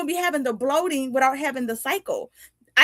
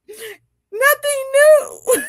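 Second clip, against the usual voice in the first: high, loud, drawn-out comic wail; mock crying; 7 dB louder, much slower than usual. Telling the lash tech what's wrong, mock crying. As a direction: wail it slowly through a laugh.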